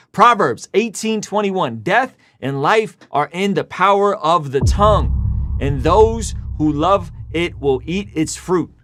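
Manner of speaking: slow, solemn Bible reading; scripture reading. Acted. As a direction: read the verse slowly and gravely. Reads scripture.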